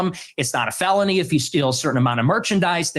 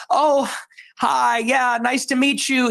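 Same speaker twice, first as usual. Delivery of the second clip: higher, syrupy-friendly mock greeting; 8 semitones higher, slower than usual. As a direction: lightly parody a gullible, overly polite stranger.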